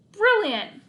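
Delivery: high, loud, thrilled exclamation; excited shout; delighted. A narrator's reading. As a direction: cheer your own idea. Otto delighted with own idea.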